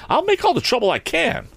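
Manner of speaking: put-on high, defiant young voice; bold cry. A narrator's reading. Tom defies the angry man, bold cry. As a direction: cut in boldly, pitched well up. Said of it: "cried Tom, and he boldly faced".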